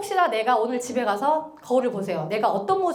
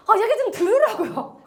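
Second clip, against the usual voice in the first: higher, louder, exasperated protest with a laugh; frustrated complaint; 9 semitones higher, 4 dB louder than usual. Retold or imitated. Partner on the phone telling her to listen, exasperated. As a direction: plead half-laughing to be heard. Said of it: "통화하다가".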